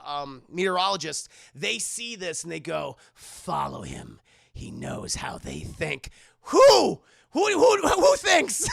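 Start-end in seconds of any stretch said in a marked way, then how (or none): 3.2-6.1 much lower, slow, menacing order; mock ominous villain. Jonas ordering his meteorologists, slow mock-menacing villain. 6.4-8.7 high, loud disbelief; incredulous shout. Real, not acted. Streamer mocking the film, high incredulous shout.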